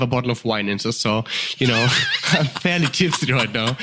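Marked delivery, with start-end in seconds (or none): 2.1-3.8 said through a laugh; amused, laughing confession. Real, not acted. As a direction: laugh as you admit it. Laughs while admitting to being tipsy.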